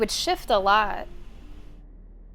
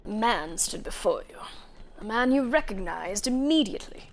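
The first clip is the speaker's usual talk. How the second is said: story narration; 3 dB quieter than usual. Acted. Reads game narration in voice.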